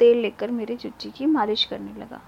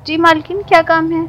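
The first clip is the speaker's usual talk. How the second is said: eager servant reply; 6 semitones higher, 13 dB louder than usual. Acted. Servant answers the mistress's call.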